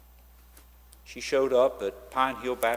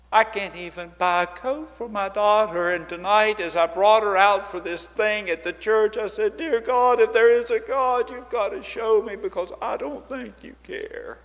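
pleading mother; 9 semitones higher, 6 dB louder than usual. Retold or imitated. Desperate mother pleading to pastor.

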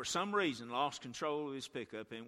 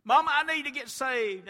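put-on child pleading; 13 semitones higher, 13 dB louder than usual. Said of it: "pulled on my skirt and said".

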